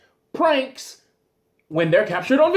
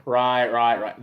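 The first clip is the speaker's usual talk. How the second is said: dawning realization, low; 10 semitones lower than usual. Retold or imitated. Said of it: "there's still the".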